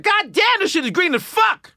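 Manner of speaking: very high comic exclamation; hammed-up, high-pitched cursing in amazement; Eddie Griffin. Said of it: "He come off the airport like".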